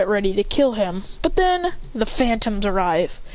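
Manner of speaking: usual speaking voice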